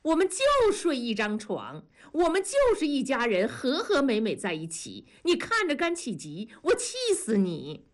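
high, smug gloating, last words slowed; wife gloating at her rival, slowing on the last jab; gloating taunt. A narrator's reading. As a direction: rub it in with a grin. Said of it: "庄嫂反而笑了说".

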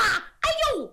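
high yelp of a struck soldier; pained cry. Acted. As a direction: cry out as the rock hits. Soldier hit by boulder.

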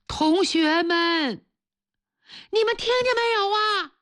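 teacher calls out to his trapped students, high and desperate. A narrator's reading. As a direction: call out to them with your last hope. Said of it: "他预感到不妙".

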